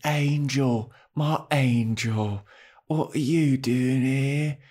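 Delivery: slow, drawn-out put-on accent; Drusilla's accent. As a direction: stretch the vowels in an odd mock accent. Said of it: "I can't get over this accent".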